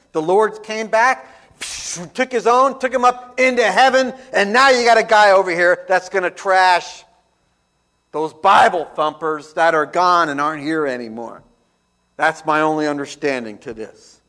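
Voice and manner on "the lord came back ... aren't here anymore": loud, driving pulpit preaching; full-force preaching. Acted. Preacher telling the church of the rapture, loud preaching. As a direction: hammer it home to the congregation.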